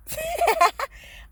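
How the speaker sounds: sudden high giggle, embarrassed; shy embarrassed laugh; burst of high laughter. Real, not acted. Laughs at being shy on camera.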